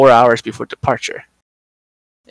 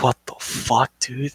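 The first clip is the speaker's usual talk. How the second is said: muted disbelief; 8 dB quieter, slower than usual. Retold or imitated. Agent reacting to a vibrating passenger, quietly stunned. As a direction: say it under your breath, baffled.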